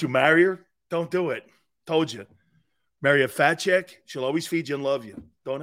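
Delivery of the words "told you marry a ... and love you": usual speaking voice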